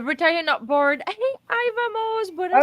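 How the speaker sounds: high-pitched cheerful hello; excited greeting. Real, not acted. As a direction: call out a happy welcome. Greeting a viewer in chat.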